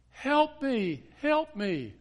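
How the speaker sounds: high, loud cry for help; begging frantically from the road; panicked woman crying out; desperate plea. Retold or imitated.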